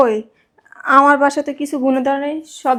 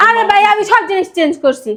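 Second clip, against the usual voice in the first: exasperated protest; 6 semitones higher than usual. Acted. Protesting to brother in argument.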